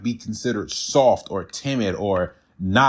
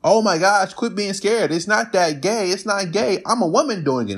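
imitating a gushing woman, higher; high, gushing mock of a woman pushing him; mocking woman's voice; 8 semitones higher than usual. Mimicking women's advice.

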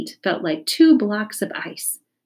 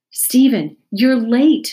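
stern teacher; 6 semitones higher, 7 dB louder, slower than usual. A narrator's reading. Teacher scolding a late pupil.

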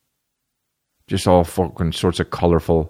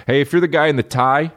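mock call-out; 10 semitones higher than usual. Calling out to the ad writer.

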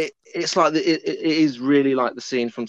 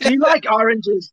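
high, loud mock offer of oranges; hammed-up offer; 7 semitones higher, 4 dB louder than usual. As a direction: put on an odd sweet voice. Mock-innocent offer, film quote.

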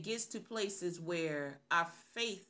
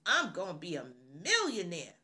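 confident boast; 4 dB louder than usual. Acted. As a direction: declare it loudly with total confidence. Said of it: "We say those things with confidence".

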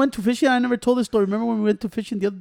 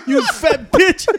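loud, high, drawn-out jeer; cracking up while ribbing a friend; laughing insult; 8 semitones higher, 5 dB louder, much slower than usual. Real, not acted. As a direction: stretch the insult out through laughter. Teller ribs laughing friend.

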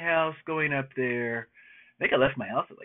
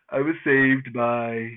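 usual speaking voice

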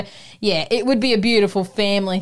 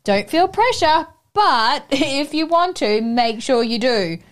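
higher, slow and gentle, with a laugh; loving encouragement; 5 semitones higher, slower than usual. Retold or imitated. Mother-in-law lovingly urging her to ride, slow and gentle. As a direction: coax her kindly, slowly. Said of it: "lovingly was like".

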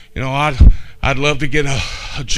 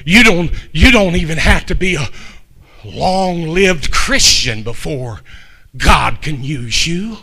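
ordinary delivery

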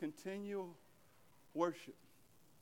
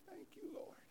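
breathy whisper of thanks to God; a hushed thank-you whispered in prayer; 12 dB quieter than usual. Shown as a demonstration. Preacher models a private morning prayer, whispered.

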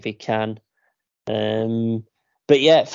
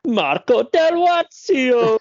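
high, slow, drawn-out name announcement; grand announcement; 12 semitones higher, 5 dB louder, much slower than usual. Pretend. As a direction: announce the caller like a ring MC.

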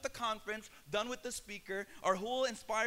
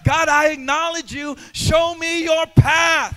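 fervent prayer; 10 dB louder than usual. Acted. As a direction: pray it, swell with each line until you cry out. Prayer swelling to a shout.

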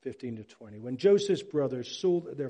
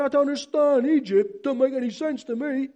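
high, loud baffled complaint; mock bewilderment, voice pitched up; fumbling confusion; 8 semitones higher, 6 dB louder than usual. Jacob fumbling with a little picture, confused and high-pitched. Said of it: "Jacob's fumbling around like all of us".

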